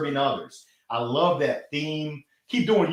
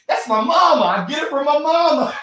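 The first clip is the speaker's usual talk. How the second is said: high, loud delighted shout-out; proud, delighted call to mama; excited; 11 semitones higher, 6 dB louder than usual. Real, not acted. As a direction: beam it out to your mom. Excited shout-out to his mom.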